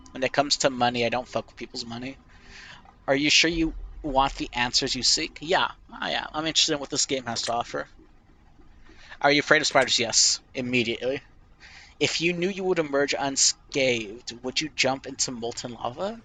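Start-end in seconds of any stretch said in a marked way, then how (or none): none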